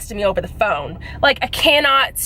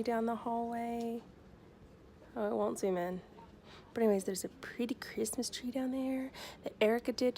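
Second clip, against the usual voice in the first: unvoiced hushed telling; whisper; 20 dB quieter than usual. Real, not acted. Vlogger at the office pointing out Erica's tree, whispering. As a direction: keep it hushed in the hallway.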